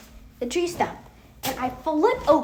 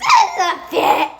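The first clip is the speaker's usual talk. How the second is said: loud, drawn-out mocking brother voice; taunting brother; 4 semitones higher, 7 dB louder, slower than usual. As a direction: stretch it out, imitating a teasing brother. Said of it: "if my brother says".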